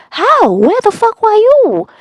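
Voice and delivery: mock-alarmed mom asking where her daughter was; shocked mom.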